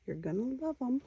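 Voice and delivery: high, playful singsong; singsong it high, climbing further on the repeat; silly high-pitched put-on voice. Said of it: "why I'm talking like that".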